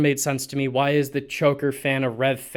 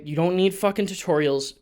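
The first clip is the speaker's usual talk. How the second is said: ordinary delivery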